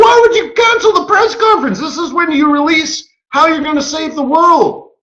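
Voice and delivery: laughing, high-pitched mock indignation; high incredulous outcry. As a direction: shriek the question in mock outrage. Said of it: "They're like".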